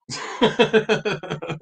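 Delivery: light laugh, trailing downward; real chuckle. Real, not acted. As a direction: chuckle lightly after teasing a friend. Laughing after teasing Coleman back.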